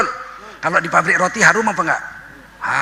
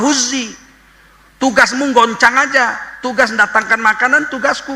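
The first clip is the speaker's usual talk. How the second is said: commanding God; 6 semitones higher than usual. Acted.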